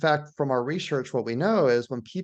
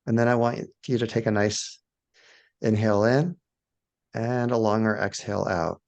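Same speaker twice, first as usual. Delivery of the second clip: guided meditation; 2 semitones lower than usual. Shown as a demonstration. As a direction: gently guide listeners into awe, phrase by phrase. Leads a guided awe meditation.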